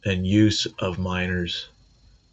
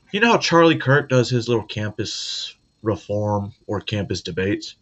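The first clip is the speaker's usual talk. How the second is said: ordinary talk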